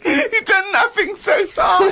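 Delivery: slow, high, breaking with laughter; laughing. Real, not acted. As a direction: squeeze it out mid-laugh. Laughing at Karl.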